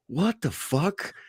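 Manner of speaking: high, slow, drawn-out disbelief; stunned. Retold or imitated. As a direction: jaw on the floor. Sergeant stunned seeing fighters.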